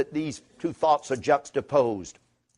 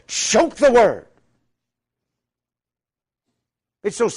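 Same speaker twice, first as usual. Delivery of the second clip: slow, building pulpit preaching, rising higher and louder; fervent preaching; 7 semitones higher, 7 dB louder than usual. Acted. Preacher warning his flock about loving things, in a slow fervent preach. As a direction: drive the warning home from the pulpit.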